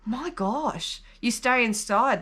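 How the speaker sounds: normal talking voice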